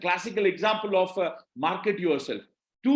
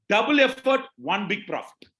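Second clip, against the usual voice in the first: rally the room with the mantra; slogan punch. Real, not acted. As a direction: hit double hard, then let the rest fall. Slogan chanted to the audience.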